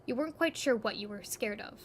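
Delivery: ordinary talk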